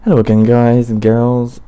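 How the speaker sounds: loud, slow, upbeat channel intro; presenter greeting. Shown as a demonstration. Restarting his video intro for the viewers, loud host voice. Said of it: "start again".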